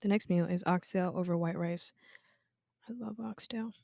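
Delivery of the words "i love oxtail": whispered. Real, not acted. Repeats the line, whispered first.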